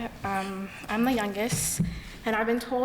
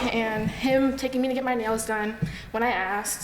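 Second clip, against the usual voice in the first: ordinary talk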